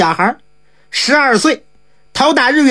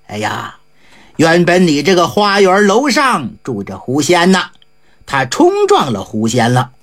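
very low, measured monk voice; Jigong's voice; 8 semitones lower than usual. A narrator's reading. Monk Jigong explaining the fox spirit to the squire, very low.

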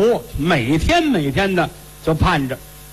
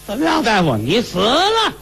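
tactless doctor; 7 semitones higher than usual. Acted. Blunt young doctor tells patient.

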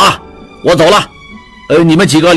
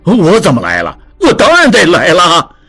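huffy retort; 6 semitones higher than usual. A narrator's reading. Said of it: "哼了一声说".

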